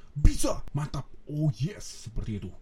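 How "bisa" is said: sudden high, loud "bisa"; loud affirmation. Shown as a demonstration. Trainer shows a client how to say "bisa" louder, high and loud. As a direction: punch out "bisa" as a sharp, loud high cry. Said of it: "Kalau mau ditambah ngomongnya".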